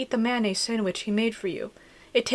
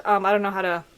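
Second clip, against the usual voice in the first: ordinary delivery, nothing marked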